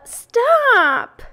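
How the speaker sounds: very high, loud drawn-out yell; panicked scream. A narrator's reading. Pig cuts off the old sheep, screaming in panic. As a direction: scream to cut the speaker off. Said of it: "screamed Wilbur".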